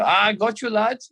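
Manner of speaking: high mock Scouse-German gotcha; Scouse German accent. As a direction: imitate a prankster teammate in a Scouse-German accent. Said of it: "this weird Scouse German accent".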